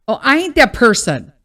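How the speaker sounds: ordinary talk, nothing marked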